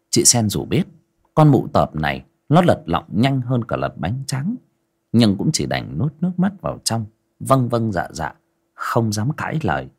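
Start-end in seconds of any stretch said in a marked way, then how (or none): none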